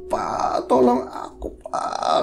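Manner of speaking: faint, breathless whispered plea for help; exhausted plea. Retold or imitated. Worn-out wife begging her husband for help, weak whispered plea. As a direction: imitate someone too weak to call out. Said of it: "saking kepayahan begitu, katanya gini".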